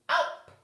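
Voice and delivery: sudden high, loud gulp; a big comic gulping sound; loud high swallowing noise; mock gulp. A narrator's reading. Tiger swallows in one go.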